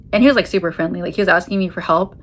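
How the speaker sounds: ordinary talk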